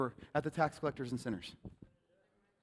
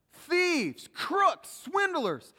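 shouted; 12 semitones higher, 5 dB louder, much slower than usual. Acted. Preacher calling out answers.

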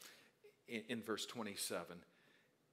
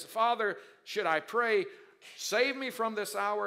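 raised, loud asking; earnest plea; 10 semitones higher, 12 dB louder than usual. Acted. Jesus questioning the Father before the cross, earnest plea. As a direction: ask the Father, pressing but steadier. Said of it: "Jesus confesses".

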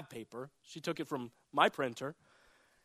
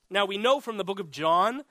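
normal talking voice

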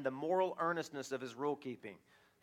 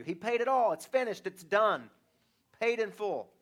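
higher, louder declaration that the debt is paid; triumphant proclamation; 5 semitones higher, 6 dB louder than usual. Real, not acted. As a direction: proclaim it like a victory. Preaching climax on grace.

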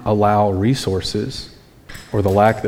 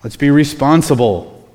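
higher, louder mock lecturing; snippy, sarcastic; 6 semitones higher, 4 dB louder than usual. Disciples correcting Jesus, snippy and sarcastic. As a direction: talk down to him with raised, sarcastic pitch. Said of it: "They get a little snippy and sarcastic with Jesus".

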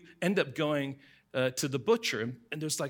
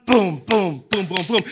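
loud chanted drum booms; drum beat imitation; 6 semitones higher, 14 dB louder than usual. Mimicking the stadium drum after the chant, loud booms. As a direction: pound out the booms like stadium drums.

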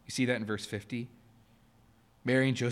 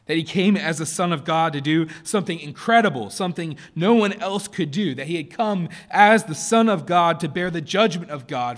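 louder, building proclamation; preaching climax; 5 semitones higher, 9 dB louder than usual. Real, not acted. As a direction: lift the congregation with conviction.

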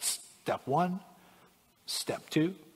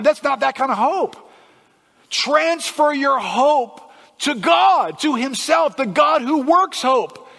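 high, loud, emphatic preaching; impassioned preaching; 11 semitones higher, 9 dB louder than usual. Real, not acted. Preacher urging transfer of hope to God, loud and emphatic. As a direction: exhort them at full force.

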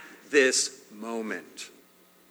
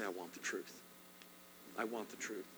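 soft, lower private plea to God; quiet prayer; 5 semitones lower, 19 dB quieter than usual. Shown as a demonstration. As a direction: pray it alone, barely above a breath. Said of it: "spend some time alone with God and say".

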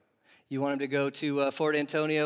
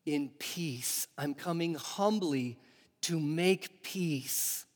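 softer, slow and humble, quiet peace; gentle humble king; 7 dB quieter, slower than usual. Retold or imitated. Preacher voicing Jesus, coming humbly in peace, soft and slow. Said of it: "he's also saying".

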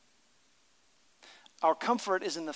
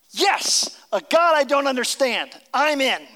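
higher and louder, eager and joyful; eager believer; 7 semitones higher, 7 dB louder than usual. Retold or imitated. Imitating a believer embracing mystery, excited. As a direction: say yes with delight. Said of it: "Other people say".

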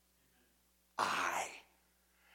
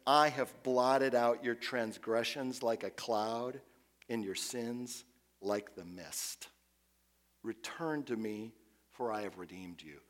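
slow reverent reading; 4 semitones lower, 6 dB quieter, slower than usual. Acted.

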